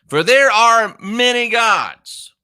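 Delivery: high, slow, forceful declaration; emphatic proclamation. Real, not acted. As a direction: proclaim it slowly and high, each word weighted. Proclaiming his main point.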